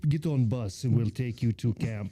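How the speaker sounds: put-on slow, broken-English Russian accent. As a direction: attempt a makeshift Russian accent, taking it slowly. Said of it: "Why do you sound Russian?".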